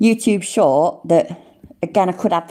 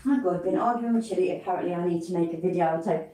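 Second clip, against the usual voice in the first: much softer, quick murmured reading; reading under his breath; 11 dB quieter, faster than usual. Real, not acted. Streamer reading a viewer comment, hushed and fast. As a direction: mutter through a chat message to yourself. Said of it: "I'm trying to read what someone says".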